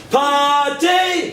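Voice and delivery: high, punchy shout of the cue word; called-out cue. Shown as a demonstration. Comic poet cues the audience to shout back, high call. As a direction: fire it out so the crowd yells it back. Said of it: "I want you to shout it back at me".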